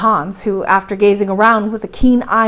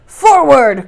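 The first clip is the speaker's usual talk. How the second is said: loud, higher rallying cry; cried out; 4 semitones higher, 9 dB louder than usual. A narrator's reading. Uncle ordering the party into the gallery, crying out. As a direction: call the command out boldly. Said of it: "cried my uncle".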